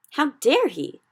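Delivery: loud, high outrage; indignant cry. A narrator's reading. Sophie outraged at the giant, loud. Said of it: "Sophie cried".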